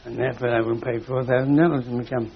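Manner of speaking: usual speaking voice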